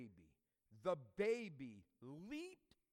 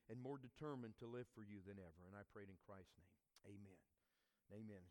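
soft, fading closing prayer; 4 semitones lower, 5 dB quieter than usual. Acted. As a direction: pray it quietly to the Father. Pastor prays after the reading.

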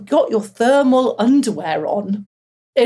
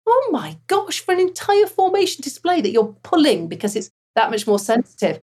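incredulous thought; 7 semitones higher than usual. Retold or imitated. Recalling her disbelief at colleague's account.

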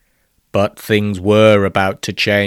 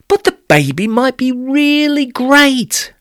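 put-on girl voice; 12 semitones higher than usual. Romalie's quoted line.

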